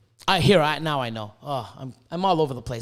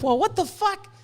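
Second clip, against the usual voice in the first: incredulous; 12 semitones higher than usual. Retold or imitated. His reaction to motivational speakers.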